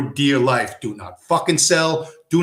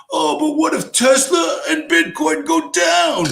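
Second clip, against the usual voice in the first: high, slow whining mockery; mock worried banker; 12 semitones higher than usual. Mocking Wall Street's fake concern in a whine. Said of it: "They're concerned".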